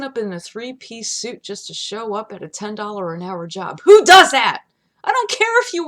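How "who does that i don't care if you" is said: loud scoff. Real, not acted. Scoffing at overdressed workers.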